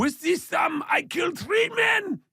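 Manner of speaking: put-on big shouted German villain accent, high and loud; hammed-up German accent, shouting. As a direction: bark it out like a menacing German. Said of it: "German accent".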